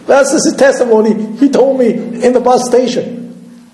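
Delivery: amused, laughter spilling into the words; laughing. Real, not acted. Laughs while ending the story.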